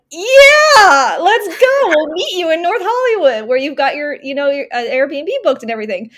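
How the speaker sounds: shouting let's go; friends cheering. Retold or imitated. Friends in LA cheer her on by text, excited shout. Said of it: "And they were like".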